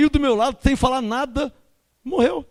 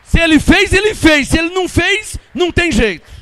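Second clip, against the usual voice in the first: loud, rising preacher's cry; fiery pulpit declaration; preaching shout; 5 semitones higher, 6 dB louder than usual. Real, not acted. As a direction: proclaim it to the whole church. Preacher exhorting congregation at full force.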